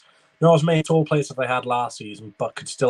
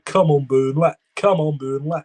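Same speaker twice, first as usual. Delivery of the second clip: higher, chanting Lancashire fan; not-very-good Lancashire accent, pitched up; attempted Lancashire accent; 3 semitones higher, 3 dB louder than usual. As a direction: mimic away fans in a rough Lancashire accent. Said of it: "my attempt of a, uh, Lancashire accent".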